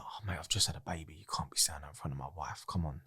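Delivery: low, very soft near-whisper; discreet, half-whispered objection; hushed protest. Retold or imitated. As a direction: plead under your breath so your wife can't hear. Confiding, keeping it from wife.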